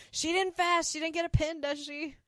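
mock Mary Jo sniping at Suzanne; rude jab. Mary Jo needling Suzanne about the pin, snide. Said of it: "Mary Jo's being kinda rude".

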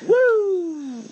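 high whoop, then slow and dreamy; giddy whoop. Pretend. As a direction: play it blissed out and loopy. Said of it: "too many Percocets".

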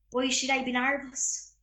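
put-on Irish accent demo. Shown as a demonstration. Young actor showing off dialect coaching, Irish accent. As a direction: say it as an Irish character would. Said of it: "So for my Irish accent".

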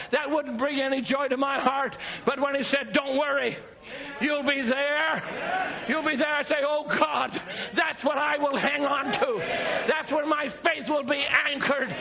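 usual speaking voice

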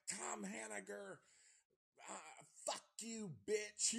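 mock scream. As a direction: yell it up high like the trapped killer. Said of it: "he screams to him".

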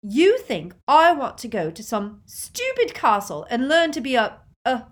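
loud, high indignant rant; scornful outburst. A narrator's reading. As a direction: throw it at your sister, loud and high, breaking off. Sisters quarrel at platform.

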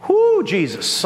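ordinary talk, nothing marked

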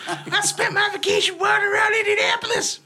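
high, loud hammed-up rant; mock outraged shout. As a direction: yell at the friend who abandoned you. Retold rant at Ken.

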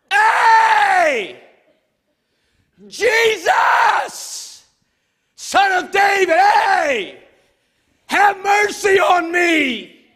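very high, loud, stretched yell; all-out shout. Acted. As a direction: scream it so he cannot ignore you. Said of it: "I must not have cried loud enough".

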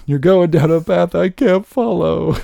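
hammed-up tearful plea, high, loud and slow; mock crying. Imitating crying Padme pleading with Anakin in Star Wars, mock crying. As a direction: wail it like an overdone movie breakup. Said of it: "what is that where she's crying".

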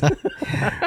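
light amused laugh. Real, not acted. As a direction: laugh at the funny phrase. Finds the waistbands funny.

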